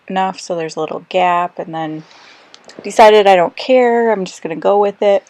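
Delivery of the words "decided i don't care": usual speaking voice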